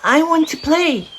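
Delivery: a tiny eager bear cub pleading; little bear voice. A narrator's reading. Little Bear's voice in story.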